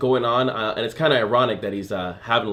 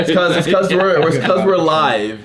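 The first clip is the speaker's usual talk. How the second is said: higher, louder teasing through laughter; laughing accusation; 6 semitones higher, 4 dB louder, slower than usual. Real, not acted. Host accusing friends of lying on air, laughing. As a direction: tease them while laughing.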